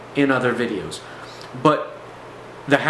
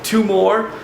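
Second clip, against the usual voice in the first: higher, anxious mock answer; raised-pitch nervous imitation; imitated shaking fear; 6 semitones higher than usual. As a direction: act out a scared man's short reply. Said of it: "he's, like, totally shaking at this time".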